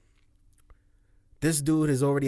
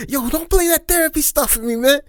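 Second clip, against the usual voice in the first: higher, louder, exasperated imitation; mock Joe's interviewer, pitched up and annoyed; mock Taxstone voice; 13 semitones higher, 6 dB louder than usual. Retelling Taxstone telling Joe to stop, mock exasperated. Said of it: "you see how this dude is like".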